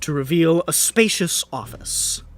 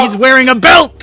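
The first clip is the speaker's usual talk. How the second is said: higher, louder exclamation; laughing shout; 10 semitones higher, 14 dB louder than usual. Real, not acted. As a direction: shout over the table's chaos. GM shouts over chaos.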